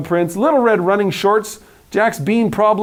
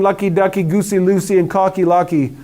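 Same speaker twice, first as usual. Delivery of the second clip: ordinary delivery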